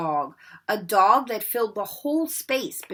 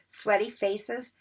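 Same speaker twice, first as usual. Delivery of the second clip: ordinary delivery, nothing marked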